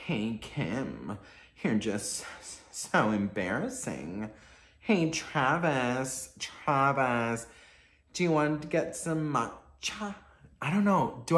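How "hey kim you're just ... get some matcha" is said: slow, drawn-out reality-star impression; halting celebrity drawl; Kourtney Kardashian. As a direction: drag each word out, pausing between phrases. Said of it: "I feel like I could do Kourtney's voice".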